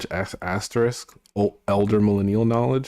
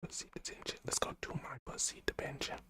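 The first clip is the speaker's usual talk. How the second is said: whispered under breath; 6 semitones higher, 20 dB quieter, faster than usual. Real, not acted. Streamer whispering to herself off mic. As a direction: breathe it out to yourself. Muttering to self, unvoiced.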